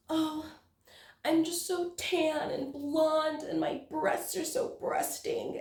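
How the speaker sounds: high, slow, mocking airhead voice; high airy mock of a vain narrator. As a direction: parody a vain heroine, slow and high-pitched. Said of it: "they're like".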